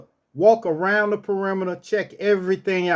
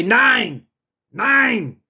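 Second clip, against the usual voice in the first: firm, raised "Nine"; higher, forceful German no; authoritative command; 4 semitones higher than usual. Shown as a demonstration. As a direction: tell the dog no with authority. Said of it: "you got to say it with authority".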